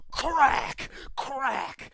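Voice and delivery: a whip snapping twice; loud whip crack sound. Acted. Overlord's whip cracking over the workers, loud.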